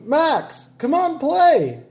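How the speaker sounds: high called-out shout; high holler to a friend. A narrator's reading. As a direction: holler across to his friend's house. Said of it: "he hollered".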